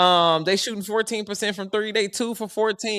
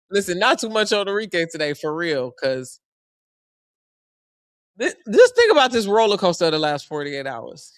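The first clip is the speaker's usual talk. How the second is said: ordinary delivery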